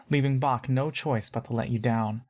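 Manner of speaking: plain reading voice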